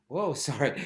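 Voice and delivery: ordinary talk, nothing marked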